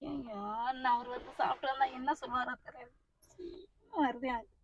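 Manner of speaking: very soft, low moaning; worried lament. Acted. Mother dreading what father will say, quietly despairing. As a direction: mutter your dread to yourself.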